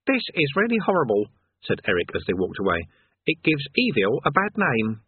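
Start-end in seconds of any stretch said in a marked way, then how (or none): 0.0-1.3 put-on higher, earnest boy's voice; a know-it-all lad, put off; young Eric. Eric to Rincewind walking away, higher boyish voice. 3.2-5.0 put-on higher, earnest boy's voice; a know-it-all lad, put off; young Eric. Eric to Rincewind walking away, higher boyish voice.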